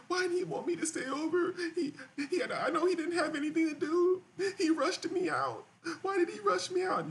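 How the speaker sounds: a hammed-up parody of a crying date, broken by laughs; mock crying woman. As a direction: squeal it like a jilted date crying at home. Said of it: "You're gonna be at your home crying like this".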